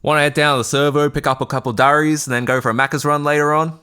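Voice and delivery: put-on broad Australian accent. Australian streamer doing the accent chat asked for, broad Aussie voice. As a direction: lay the Australian slang on thick, mate. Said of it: "Please don't skimp on the accent".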